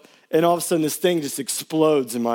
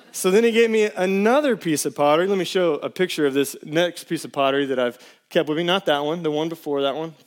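ordinary delivery

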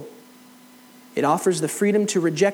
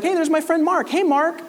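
put-on bright, much higher hail to an old friend; cheery greeting; 12 semitones higher, 3 dB louder than usual. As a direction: greet your buddy across the room. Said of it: "He's like".

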